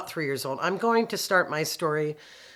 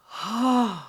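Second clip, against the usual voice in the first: big audible exhale, high and airy; sigh of release; 6 semitones higher than usual. Retold or imitated. Speaker shows the new breath after praying, relieved sigh. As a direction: re-enact letting out a huge sigh. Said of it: "I was like".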